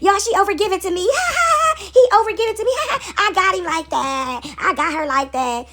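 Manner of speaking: high, loud gloat broken by cackles; gloating schemer. Acted. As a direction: crow over the victim, laughing. Voicing gloating people who exploit you.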